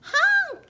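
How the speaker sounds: loud high honk; yelled truck honk. A narrator's reading. Narrator voicing a dump truck demanding room, yelled honk. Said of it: "yelled a dump truck".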